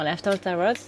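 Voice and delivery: French accent on the English title. Real, not acted. Naming the English deck for viewers, French accent. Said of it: "mon accent est absolument formidable".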